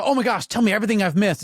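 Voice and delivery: fast, high, loud gush of excitement; hammed-up excited squeal of interest; mock gushing. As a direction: imitate an over-eager friend demanding all the news. Mock eager catch-up quote.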